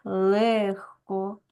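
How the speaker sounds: "легко" very slowly with Г as Х; devoiced Г. Shown as a demonstration. Teacher dictates transcription to pupils, devoiced Г. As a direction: stretch "легко" out, breathing a voiceless Х for Г. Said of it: "на глухий".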